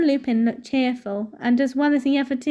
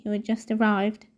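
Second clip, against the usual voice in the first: plain reading voice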